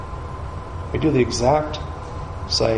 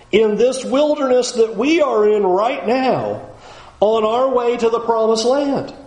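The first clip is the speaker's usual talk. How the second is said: preaching at full force; 6 semitones higher than usual. Acted. Preaching builds again.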